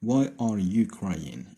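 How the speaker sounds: words drawn out one by one; very slow dictation. Shown as a demonstration. Teacher repeating the example question to students, very slow. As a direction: dictate it slowly to the class. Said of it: "وكنبدلو لو طون".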